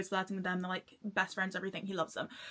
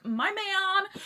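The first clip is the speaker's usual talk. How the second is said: high, thrilled cry; excited high-pitched claim; fangirl gushing; 9 semitones higher than usual. Real, not acted. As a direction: cheer for your favourite book crush. Gushing over favourite character's book announcement.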